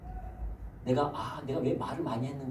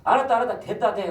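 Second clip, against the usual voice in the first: higher, louder huff of someone storming off; offended friend ending the talk, loud and sulky; miffed friend; 8 semitones higher, 8 dB louder than usual. Retold or imitated. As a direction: brush him off in a huff.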